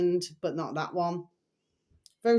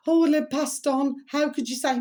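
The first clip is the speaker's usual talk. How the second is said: raised-pitch imitation of a disapproving critic; mock critic; 7 semitones higher, 3 dB louder than usual. Mocking those who'd tell her off for speaking ill of the dead. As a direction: play the scolding voice of the people who'd judge her. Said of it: "Some would say".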